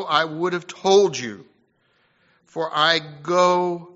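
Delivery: loud, ringing pulpit voice building to a climax; full-force preaching. Acted. Preacher exhorting the congregation, at full force.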